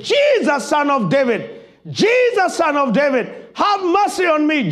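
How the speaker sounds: high, loud desperate cry of a blind beggar. Acted. Blind man crying out to Jesus over the crowd, loud pleading cry. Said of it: "he made so much noise above the voices".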